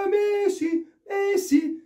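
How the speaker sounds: high, stretched Messi Messi, stadium-announcer style; mock announcer hailing Messi. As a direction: call out the name like the stadium announcer.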